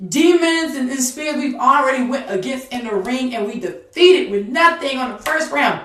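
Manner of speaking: loud, high ringside cheering; rousing cheer. Acted. Cloud of witnesses cheering on a tired fighter, loud rousing cheer.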